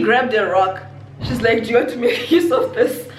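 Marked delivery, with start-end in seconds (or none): 1.6-3.1 laughing offer. Retold or imitated. Friend offers a rock.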